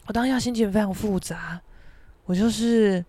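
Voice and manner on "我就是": usual speaking voice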